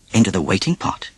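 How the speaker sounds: ordinary delivery, nothing marked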